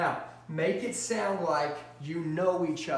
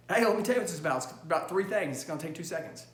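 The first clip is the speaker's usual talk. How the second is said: rapid-fire phone pitch; sped up; faster than usual. Shown as a demonstration. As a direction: rattle it off real fast, punchy start. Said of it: "I speed up real fast".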